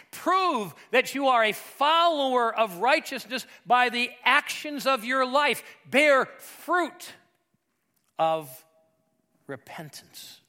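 loud, high, driven pulpit delivery; slow, emphatic sermon at full force; impassioned preaching. Acted. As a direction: preach it out, building to a forceful climax.

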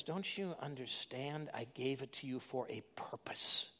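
low, slow whispered reproach; whispered rebuke. Acted. Master rebukes fearful servant, low slow whisper. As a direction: hiss the rebuke, disappointed.

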